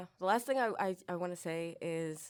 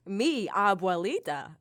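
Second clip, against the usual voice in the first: slow, louder Spanish in a gringo accent; 3 semitones higher, 7 dB louder, slower than usual. Real, not acted. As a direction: say "Mi abuelita" slowly with a gringo accent. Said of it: "sorry for my gringo accent".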